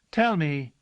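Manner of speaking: put-on a girl crying out a question to her servant; cried out. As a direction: call it out to the servant. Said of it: "she cried".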